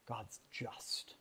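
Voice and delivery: ordinary delivery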